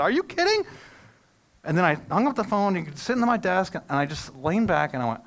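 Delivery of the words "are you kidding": higher still, stunned exclamation; disbelieving out loud. Retold or imitated. Pastor repeating his shocked reaction to the bad news, high and incredulous. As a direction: cry out in disbelief to nobody. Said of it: "I said".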